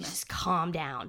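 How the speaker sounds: low, soft, slow mock-soothing voice. Host mock-soothing an angry public, slow and soft. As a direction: lull them like a fake therapist.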